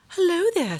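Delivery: high, sweet greeting; a friendly butterfly says hello; gentle butterfly. Acted. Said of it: "said the butterfly".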